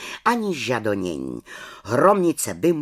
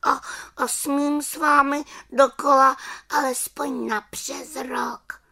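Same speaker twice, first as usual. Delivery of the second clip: timid little witch; 13 semitones higher than usual. Acted.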